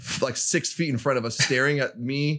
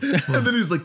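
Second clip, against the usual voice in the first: usual speaking voice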